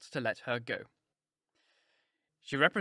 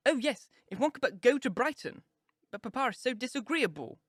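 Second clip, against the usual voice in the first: put-on high, eager sighing girl; gushing girl; 10 semitones higher than usual. A narrator's reading. Lydia echoing her mother, high and gushing. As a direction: echo the wish with a girlish gush.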